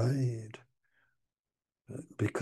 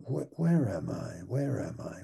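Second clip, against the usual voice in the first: usual speaking voice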